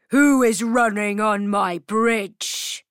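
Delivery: loud gruff troll voice. Acted. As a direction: boom it out like a troll. Troll speaks in the story.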